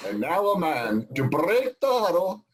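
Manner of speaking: put-on high, drawn-out French-accented call. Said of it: "that's my best French accent".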